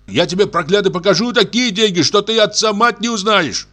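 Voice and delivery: high-pitched, worked-up threatening; riled, high-voiced menace; angry threat. A narrator's reading. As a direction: threaten him in a heated high voice. Threatens accuser in a heated quarrel.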